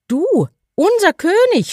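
mocking outrage from the flock; angry birds. A narrator's reading. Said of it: "schrien die Vögel zornig". (zorniger, spöttischer Vogelschrei)

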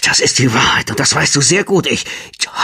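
breathless, gasping whisper; panting. Acted. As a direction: gasp it out, short of breath. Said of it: "keuchte Ophelia".

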